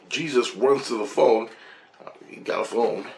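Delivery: ordinary talk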